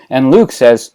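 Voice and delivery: ordinary delivery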